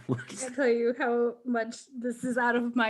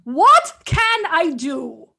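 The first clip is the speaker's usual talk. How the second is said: much louder, very slow shouted line; scrambled voice; 7 semitones higher, 14 dB louder, much slower than usual. Acted. Havilar with scrambled speech, shouting slowly. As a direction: blast it out slowly. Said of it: "It's a non-accent".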